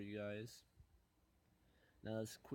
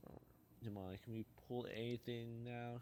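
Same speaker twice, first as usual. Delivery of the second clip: ordinary talk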